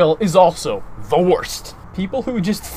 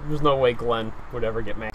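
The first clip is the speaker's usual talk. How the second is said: normal talking voice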